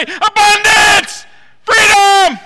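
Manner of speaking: high, loud, slow Abundance then Freedom; joyful shout. Shown as a demonstration. Preacher showing the church what he wants to cry, loud shout. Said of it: "But I wanna say".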